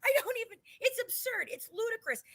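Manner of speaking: high, laughing disbelief; incredulous laugh. Real, not acted. Host laughing to cohost at absurd murderer rebrand, high.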